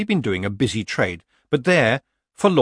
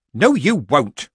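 loud, higher, firm refusal; snapped; 5 semitones higher, 7 dB louder, slower than usual. A narrator's reading. Nanny stopping Granny from leaving, snapping. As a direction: cut her off sharply and loudly. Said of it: "snapped Nanny Ogg".

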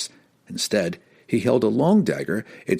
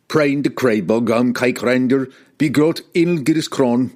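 raised, forceful character shout in a foreign tongue; loud, higher-pitched battle cry; Wulfgar shouting; 3 semitones higher, 4 dB louder than usual. A narrator's reading. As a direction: shout it out hard, every word punched. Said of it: "Wulfgar shouted".

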